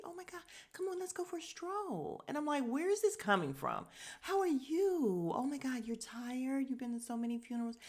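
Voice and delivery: high, gushing mock sweetness; hammed-up sugary hostess voice; extra sweet. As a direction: imitate someone fawning over a guest. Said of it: "June is extra sweet to him".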